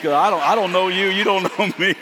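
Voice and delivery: flustered laugh at a stranger's tears; awkward laughing panic. Retold or imitated. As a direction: blurt it out amused and flustered. Awkward hug on the beach.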